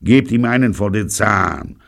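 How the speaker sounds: loud, curt command; short, loud gangster command; curt order. A narrator's reading. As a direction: bark the order at his men. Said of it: "befiehlt ihnen knapp".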